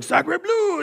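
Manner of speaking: high, drawn-out French exclamation; cartoonish French outburst; mock French accent. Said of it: "all the French archaeologists were like".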